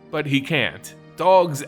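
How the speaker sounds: usual speaking voice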